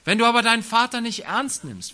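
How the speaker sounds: ordinary talk, nothing marked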